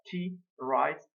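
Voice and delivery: ordinary delivery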